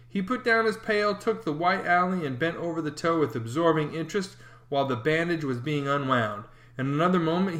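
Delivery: plain reading voice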